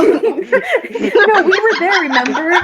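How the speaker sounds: drawn-out laughing insistence. Real, not acted. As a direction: stretch it out while laughing.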